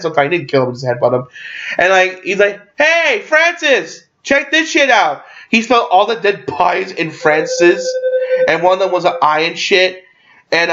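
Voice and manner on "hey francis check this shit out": high, drawn-out taunting holler; acted sing-out call. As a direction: hail an enemy from afar, stretched and pitched up. Acting Deadpool calling out to Francis.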